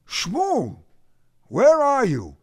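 high-pitched startled question; surprised. A narrator's reading. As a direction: ask in surprise, pitch jumping up. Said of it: "Emil asked, surprised".

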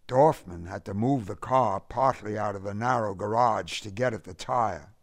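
plain reading voice

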